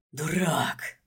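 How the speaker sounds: venomous hissed insult; snake hiss. A narrator's reading. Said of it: "змеёй прошипел".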